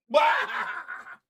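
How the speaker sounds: loud mocking shout-laugh; gloating laugh. Real, not acted. Mocking laugh at the losing fans, loud gloating. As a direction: cackle in their face.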